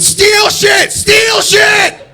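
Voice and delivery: very high, full-volume yell; hammed-up public exhortation, screamed high; shouted rallying cry. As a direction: shout it out to the whole store. Retold rallying cry to the shoppers.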